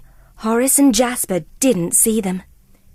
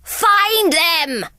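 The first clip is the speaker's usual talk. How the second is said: high villain shout; 7 semitones higher than usual. Acted. Cruella to Horace and Jasper, shouted. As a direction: bark the order. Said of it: "she shouted".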